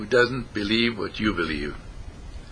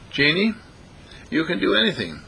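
higher, lightly put-on appeal; lightly acted calling; 6 semitones higher than usual. Preacher plays the Major addressing his genie, lightly calling. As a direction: call to her in a raised pitch.

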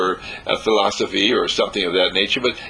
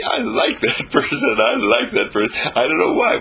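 high, gushing voice with a laugh breaking in; delighted admirer; 12 semitones higher than usual. Acted. Onlookers saying why they fall for us, giddily delighted. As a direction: gush it high, tickled by your own liking. Quoting people charmed by us.

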